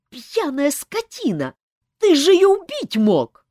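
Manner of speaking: high, loud furious yelling. A narrator's reading. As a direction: rage at the drunk brute. Olga yelling at Elmar.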